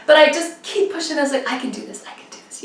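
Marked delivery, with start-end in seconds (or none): 1.5-2.6 very soft, whispered pep talk; retold self-encouragement. Struggling racer urging herself on, whispered resolve. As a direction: whisper it to yourself like a mantra.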